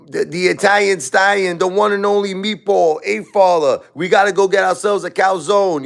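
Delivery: high, hammed-up Brooklyn Italian voice; Italian stallion bit. A hater mocking him as the Italian meatball, in a hammed-up Brooklyn Italian accent. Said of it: "So they'll be like".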